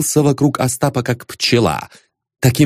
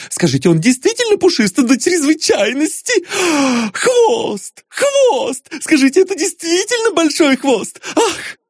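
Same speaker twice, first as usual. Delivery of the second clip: very high, gushing falsetto; squeaky, overexcited flatterer; ingratiating admirer. A narrator's reading. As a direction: fawn excitedly, with gasps of delight. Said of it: "заискивающе спрашивал".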